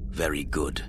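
breathy menacing whisper. A narrator's reading. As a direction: hiss the approval softly. Villain approves quietly.

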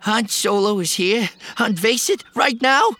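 put-on high, loud astonished young man; stunned disbelief. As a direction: gasp it out in shock. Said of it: "Post gaped at Fargle".

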